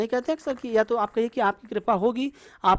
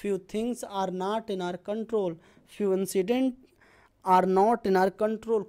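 slow model sentence; much slower than usual. Shown as a demonstration. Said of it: "कुछ चीज़ें हमारे बस में नहीं होती".

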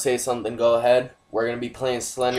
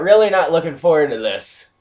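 dread; 6 semitones higher, 7 dB louder than usual. Real, not acted. Dreading the scary game.

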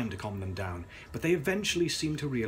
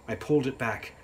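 ordinary delivery

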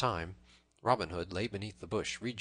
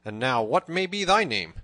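loud, higher, bold demand; bold question; 4 semitones higher, 9 dB louder than usual. A narrator's reading. Tanner demands Robin's name in turn, boldly. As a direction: ask it back boldly. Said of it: "spoke up the tanner boldly".

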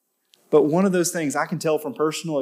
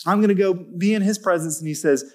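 preaching at full force; 4 semitones higher than usual. Acted. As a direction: lift the room with rising conviction.

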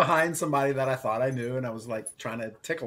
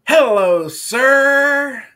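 loud, high, drawn-out yelled greeting; imitated kid's yell; 13 dB louder, much slower than usual. Schoolkid turns around and yells at a man, imitated shout. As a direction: holler it way up high like a kid at a stranger. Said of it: "looks at me and yells".

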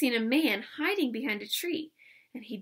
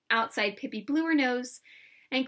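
usual speaking voice

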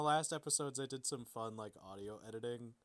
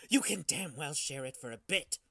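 loud, sharp snapping retort; snapped retort; 4 semitones higher, 9 dB louder than usual. Acted. Squatter snapping at Riley over the body, loud retort. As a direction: snap back without giving ground. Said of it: "the squatter snapped back".

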